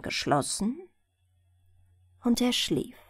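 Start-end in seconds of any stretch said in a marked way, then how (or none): none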